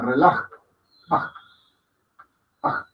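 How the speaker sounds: "relaj" ending in a breath, like reloj with a; short "A" cut off by a throat breath; aspirated final a. Shown as a demonstration. Teacher likening it to reloj, "A" with aspirated ending. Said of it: "como si dijéramos reloj, pero con A".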